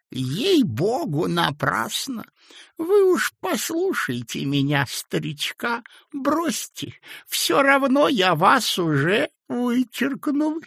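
put-on high, slow toothless old man; mumbling old man. A narrator's reading. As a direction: mumble it with gummy mouth. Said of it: "зашамкал он".

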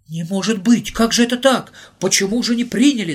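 high, loud bewildered shout; group outcry. Acted. Everyone on the platform crying out at Volodya, loud bewildered shout. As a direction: call out together in dismay. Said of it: "закричали мы все".

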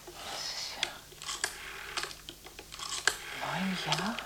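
bewildered whisper to herself. Acted. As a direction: mutter it under your breath, puzzled. Alone after call, whispering.

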